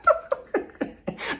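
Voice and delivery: very high airy cartoon giggle; unvoiced high-pitched cartoon giggle; cartoon laugh. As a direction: squeak out a SpongeBob doodle laugh. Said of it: "the one that says".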